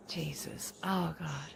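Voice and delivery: breathy hushed whisper. Real, not acted. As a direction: whisper in shock. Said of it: "unvoiced whisper".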